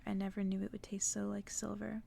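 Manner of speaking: ordinary delivery, nothing marked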